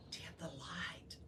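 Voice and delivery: hushed whisper; urgent whisper to dim the light. A narrator's reading. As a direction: breathe it out, no voice. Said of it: "Mary whispered".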